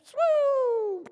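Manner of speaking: very high, loud swooping whoosh; long high swoop like someone falling; falling whistle noise. Acted. Preacher mimics daughter's cliff fall, high swoop.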